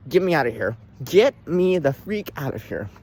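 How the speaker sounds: ordinary delivery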